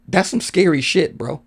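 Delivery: ordinary talk, nothing marked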